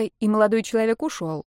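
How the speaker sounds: plain reading voice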